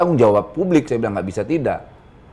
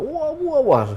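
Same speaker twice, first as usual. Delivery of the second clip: mock oration cry; slower than usual. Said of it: "lupa saya teriak-teriak".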